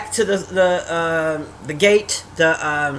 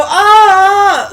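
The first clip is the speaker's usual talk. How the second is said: high, loud mock scream "aah"; retold scream; 9 semitones higher, 8 dB louder than usual. Viewer reacting to the movie whole time, retold screaming. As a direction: let out a drawn-out mock scream. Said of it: "I was screaming at the T V nonstop".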